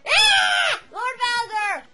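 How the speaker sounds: high shout of surprise; startled cry. Acted. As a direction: jump out of your skin. Startled servant cries out.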